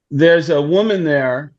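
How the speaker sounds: normal talking voice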